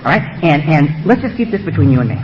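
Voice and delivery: low, soothing, conspiratorial tempter; coaxing beast. Acted. The beast proposing two secret drinks, soothing. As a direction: talk him into it gently, voice kept low. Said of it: "the beast whispers up and say".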